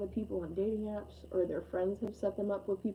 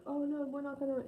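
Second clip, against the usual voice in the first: mock protest; 7 semitones higher than usual. Voices listener's objection.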